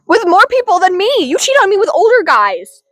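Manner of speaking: high-pitched playful accusation; mock outrage. Friend arguing who is the real victim, mock outrage. Mock quarrel over cheating.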